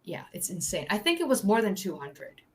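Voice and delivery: normal talking voice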